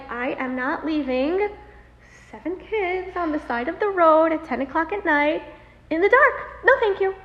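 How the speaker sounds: retold mother digging in; adamant refusal. Refusing to leave her children.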